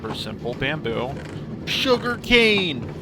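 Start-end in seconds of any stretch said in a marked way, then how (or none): 1.7-2.9 high, drawn-out gleeful call; delighted high-pitched whoop; excited cheer. Real, not acted. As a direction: cheer it, stretching the words high. Cheering the working farm.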